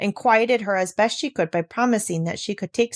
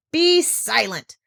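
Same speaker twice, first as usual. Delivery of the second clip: thundered; 4 semitones higher, slower than usual. A narrator's reading. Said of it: "thundered the uncle".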